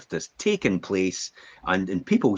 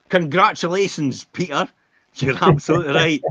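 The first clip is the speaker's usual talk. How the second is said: higher, louder hammed-up praise; mock teacher praise; 7 semitones higher, 5 dB louder than usual. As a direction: gush over the pupil like a game-show host.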